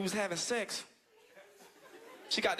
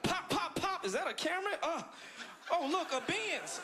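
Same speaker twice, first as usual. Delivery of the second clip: high pretend voice acting out a shooting; mock shooting scene; 9 semitones higher than usual. Comic acts out being shot beside a Camry. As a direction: play it up high and silly. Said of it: "It'd have been like".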